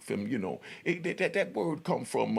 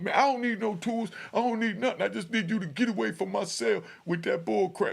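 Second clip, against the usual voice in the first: angry yell; 9 semitones higher than usual. Retold or imitated. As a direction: shove him away with your voice. Inmate yelling at the chaplain.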